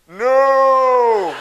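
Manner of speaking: loud, drawn-out mock-heroic no; hammed-up heroic shout; Superman-style intervention. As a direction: call it out to stop someone far off. Mock superhero rushing to stop mom.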